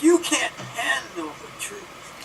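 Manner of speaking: high, very slow film-style outburst; dramatic courtroom-style parody, pitched high; mock outburst. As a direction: stretch it out as a hammed-up punchline. Film outburst as joke punchline.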